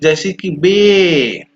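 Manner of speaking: बे said slowly with the ay stretched and no y sound; teacher modelling a long बे. Shown as a demonstration. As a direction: drag out बे into one long ay. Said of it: "ए को long खींचना है".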